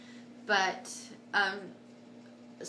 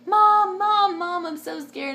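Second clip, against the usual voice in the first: much higher, louder frightened calls to Mom; scared calling for mom; 10 semitones higher, 11 dB louder, slower than usual. Retold or imitated. Calling to her mother during labour, high loud frightened cries. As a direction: cry out for your mother in fear. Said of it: "I kept telling everybody I was so scared".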